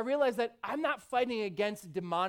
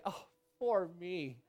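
the pity-party demon; 5 dB quieter than usual. Acted. Preacher voicing the pity demon to the church, soft mock comfort. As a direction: croon fake sympathy like the lie in your ear. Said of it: "That's how mine look".

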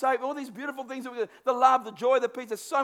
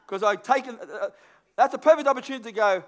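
normal talking voice

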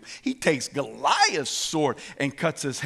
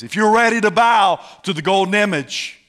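Nebuchadnezzar raging; 5 semitones higher, 7 dB louder than usual. Acted. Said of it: "King Nebuchadnezzar is raging".

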